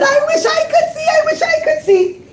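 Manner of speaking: high, longing chorus of wishing people; eager crowd begging. Acted. Townspeople begging to see the diamond, high and longing. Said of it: "Now everyone said".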